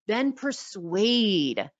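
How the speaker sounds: very slow, drawn-out persuade; drawn-out Then persuade; slow emphatic reveal. Shown as a demonstration. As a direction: spell out persuade slowly for weight. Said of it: "announcing the second P".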